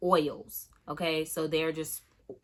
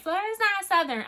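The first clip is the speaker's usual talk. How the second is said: much higher, louder mimicking of naysayers; mocking doubters; 14 semitones higher, 5 dB louder than usual. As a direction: put on a high-pitched voice to mock objectors. Mimicking doubters of her Southernness.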